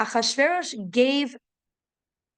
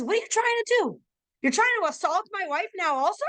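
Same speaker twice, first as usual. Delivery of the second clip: much higher accusing outburst; furious king; 8 semitones higher, faster than usual. Acted. King bursts in on Haman, accusing and much higher. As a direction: catch him in the act, voice shooting up.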